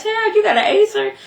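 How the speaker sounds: mock his cheap laptop; incredulous mockery. Real, not acted. Vlogger teasing a character's laptop, incredulous mockery. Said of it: "I'm like".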